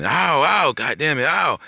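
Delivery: high, loud yelps of pain; imitating your own cursing howl; re-enacted pained yell. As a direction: yell it out like it hurts, acting it out. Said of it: "I was yelling".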